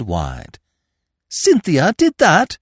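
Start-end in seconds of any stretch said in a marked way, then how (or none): 1.3-2.6 very high, loud, slow disbelief; astonished. A narrator's reading. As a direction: cry out in shocked disbelief, pitched high. Eve astonished at the accusation.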